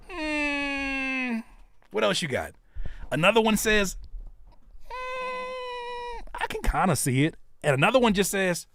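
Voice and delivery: very high mock-hurt friends' reactions; mock wounded artist. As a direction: ham up the hurt. Mimicking lukewarm friends, mock anguish.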